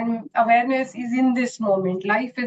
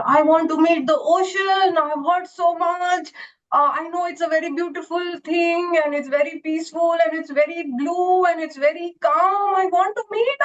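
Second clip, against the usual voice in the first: pitched well up, pleading to meet the ocean; a small wave voice, longing and higher; eager little wave; 7 semitones higher than usual. Acted. Little wave pleading with the wise old wave, high and eager.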